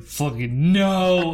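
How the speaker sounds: very slow, drawn-out refusal; emphatic refusal. Retold or imitated. Retelling waking up still drunk, drawn-out emphatic refusal. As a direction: draw out a mock-appalled no.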